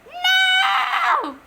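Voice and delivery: very high, loud wail. Acted. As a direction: wail your protest. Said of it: "wailed Henry".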